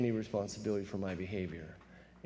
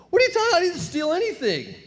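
very high, loud outraged denial; indignant denial; 7 dB louder, faster than usual. Retold or imitated. Arrested young man protests his innocence, very high and loud.

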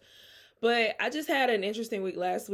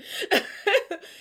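a real laugh after tripping over a tongue twister; bright laugh; 12 semitones higher, 4 dB louder than usual. Real, not acted. Host laughing at her own joke, a high real laugh. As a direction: burst out in a high, loud laugh at herself.

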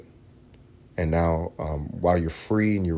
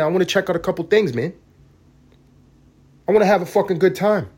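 loud, higher ranting; worked-up rant, much higher and louder; 10 semitones higher, 4 dB louder than usual. Real, not acted. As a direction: vent at full volume. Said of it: "a little less screaming".

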